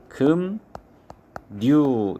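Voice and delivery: 금, 류 said as 금, 뉴 with a pause between; nasalized pronunciation. Shown as a demonstration. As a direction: break 금, 류 into "geum... nyu". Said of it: "이렇게 발음을 해야 됩니다".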